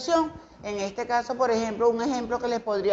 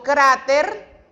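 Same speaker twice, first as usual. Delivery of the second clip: "cráter" with the strongest emphasis on "crá"; stress on first syllable; 3 semitones higher, 4 dB louder than usual. Shown as a demonstration. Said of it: "hago la mayor énfasis de voz en la palabra cra". (énfasis de voz en «crá» de «cráter»)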